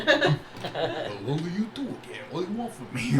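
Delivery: put-on comic fish, muddled Russian and New York accent; a wobbly Russian accent sliding toward New York; Russian-turned-New-York accent. Game master replays the fish's complaint, muddled Russian-New York accent. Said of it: "sounds like a New Yorker".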